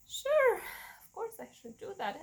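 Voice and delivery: mock-eager yes to the nurse; sarcastic agreement. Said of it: "And I'm like".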